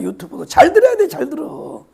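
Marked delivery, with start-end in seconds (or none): 0.0-0.5 유튜브도 repeated much softer; quiet afterthought on 유튜브도; soft echo. Real, not acted. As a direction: let 유튜브도 fall to a murmur.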